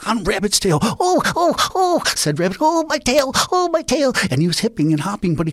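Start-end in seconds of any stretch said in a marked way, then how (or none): 0.8-2.0 much higher, louder yelps of pain; pained high cries. Acted. Rabbit crying out as the boulder crushes his tail. 2.6-4.1 much higher, louder yelps of pain; pained high cries. Acted. Rabbit crying out as the boulder crushes his tail.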